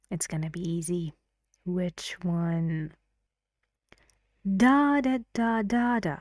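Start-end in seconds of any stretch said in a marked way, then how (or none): 4.6-6.2 strong high first "da", then stepping down; rhythm demo. Shown as a demonstration. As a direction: tap out a sentence's stresses on "da". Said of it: "sentence stress and".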